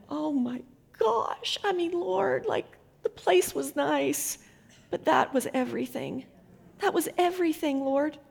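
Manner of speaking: high, drawn-out, overcome with emotion; awed prayer on the edge of tears; choked-up wonder at God's kindness; moved, tearful. Retold or imitated. Moved reply to woman's tearful story.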